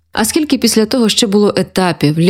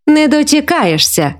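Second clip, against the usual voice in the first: higher, slow and playful; smiling retort; 7 semitones higher than usual. A narrator's reading. Mother answering son's teasing about tiredness, playful. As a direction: draw it out with a smile. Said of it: "усміхаючись".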